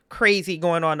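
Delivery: ordinary talk, nothing marked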